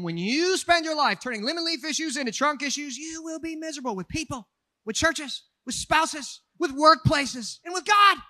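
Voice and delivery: building to a shout; preaching crescendo. Acted. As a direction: build up to a loud preaching climax. Sermon climax.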